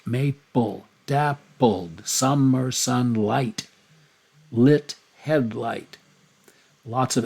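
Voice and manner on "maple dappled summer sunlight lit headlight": slow, leaning on each L word; isolated words with the L sounds pulled out; stressing the L sounds. Shown as a demonstration. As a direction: draw out maple dappled summer sunlight. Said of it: "do you hear the L sounds?".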